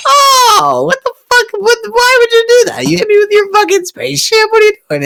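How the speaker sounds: very high shrieking panic; panicked squeal. Retold or imitated. Hit by a spaceship, shrieking in high panic. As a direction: shriek it like you just got rammed. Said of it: "and I'm like".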